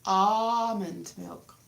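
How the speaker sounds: Almond said slowly, AH-mond with no L; slow AH-mond milk; drawn-out almond. Shown as a demonstration. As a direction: stretch out Almond milk, silent L. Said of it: "People pronounce things in different way".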